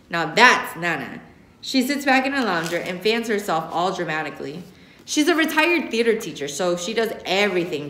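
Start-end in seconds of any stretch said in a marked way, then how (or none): none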